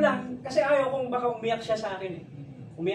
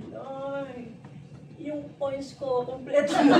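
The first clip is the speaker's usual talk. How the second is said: mother's voice; 5 semitones higher, much slower than usual. Retold or imitated. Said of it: "sabi niya".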